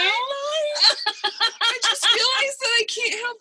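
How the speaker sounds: high, laughing through near-tears. Real, not acted. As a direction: laugh it out, half crying.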